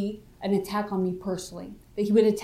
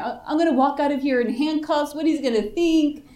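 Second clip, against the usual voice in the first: retold worried spiral in a higher voice; catastrophizing; 5 semitones higher than usual. Suspect dreads what her father will think, retold panic. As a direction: imitate mild panic, pitched up. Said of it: "you know, catastrophizing".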